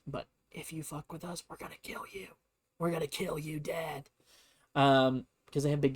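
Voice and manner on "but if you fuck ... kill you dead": soft, breathy death threat; menacing whisper. Retold or imitated. Navy whispering a threat to Japan, soft and menacing. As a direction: hush it like a quiet warning. Mock threat in gunboat diplomacy.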